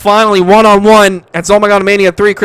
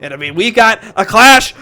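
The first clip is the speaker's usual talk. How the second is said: normal talking voice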